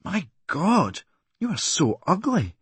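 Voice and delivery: high, slow, aghast put-on voice; a jeering punter gasping in disgust; mock shocked onlooker. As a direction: gape at him and blurt it out. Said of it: "and going".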